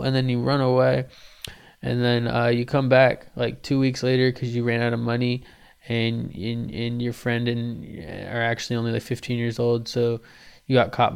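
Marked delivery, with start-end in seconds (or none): none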